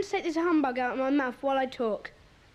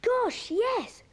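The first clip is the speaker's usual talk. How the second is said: higher-pitched burst of excitement; excited realisation; 7 semitones higher than usual. Acted. Boy reminded of a forgotten clue, excited realisation. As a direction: exclaim it as the memory hits. Boy suddenly remembers the dropped item.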